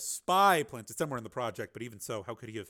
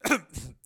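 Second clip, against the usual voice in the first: throat clearing; 4 semitones higher, 6 dB louder than usual. Acted. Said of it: "had to clear his throat".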